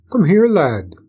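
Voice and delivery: put-on a red-haired man calling the boy over. A narrator's reading. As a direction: beckon the lad over. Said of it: "signaled to the boy".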